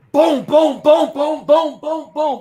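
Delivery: high, loud boom boom like fists on a door; mock door pounding; imitated banging. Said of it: "all you heard me go was".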